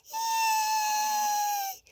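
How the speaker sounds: long, very high squealed "eek"; sustained high-pitched shriek at seeing a mouse; teacher's scream. A narrator's reading. Teacher spots a mouse, long high scream. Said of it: "Crie la maîtresse".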